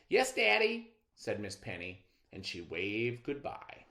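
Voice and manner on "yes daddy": put-on higher, louder little girl voice; a dutiful little daughter; obedient little girl. Daughter answers father.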